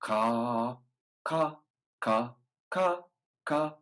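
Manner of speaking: ka, ka from the back of the tongue. Shown as a demonstration. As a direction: make the ka match the ta. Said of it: "switch to the back of the tongue".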